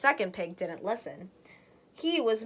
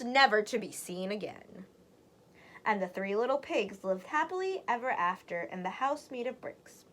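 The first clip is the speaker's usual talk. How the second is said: usual speaking voice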